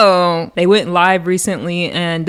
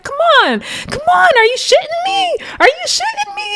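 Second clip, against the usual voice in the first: very high, pitched-up imitation yell; mock yelling. Teddy Baby yelling at the car driving off, shrill mock shout. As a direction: shriek it in disbelief. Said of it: "literally yelling at the car".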